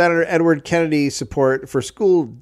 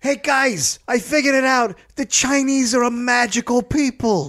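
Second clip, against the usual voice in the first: much higher, far-off announcing voice; mock eureka; 10 semitones higher than usual. Official announcing his breakthrough to staff, high mock triumph. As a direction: burst in like a madman with the answer.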